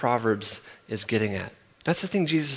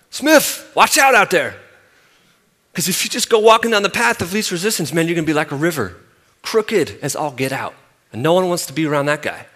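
much higher, louder Southern coach shouting a warning; 4 semitones higher, 8 dB louder than usual. Retold or imitated. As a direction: yell it like the big loud Southern coach. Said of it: "He had this super thick Southern accent".

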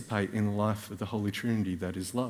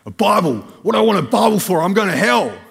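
much higher and much louder furious shout; retold yelling; 11 semitones higher, 15 dB louder than usual. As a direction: yell it right in his face. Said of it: "he starts yelling".

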